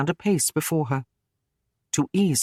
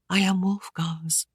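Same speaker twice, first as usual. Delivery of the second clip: low whisper; 3 semitones higher than usual. Acted. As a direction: breathe it out, barely there. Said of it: "she said low".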